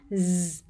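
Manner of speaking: a held, buzzing "z"; "z" drawn out on its own; isolated end sound. Shown as a demonstration. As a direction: sustain the Z alone as a teacher's demo. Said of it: "Nhớ cái âm đuôi này".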